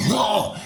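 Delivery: high sudden gasp of relief; relieved high exclaim. Retold or imitated. Man retelling the moment it came out, high oh. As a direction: let out a high startled oh. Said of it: "Then I'm like".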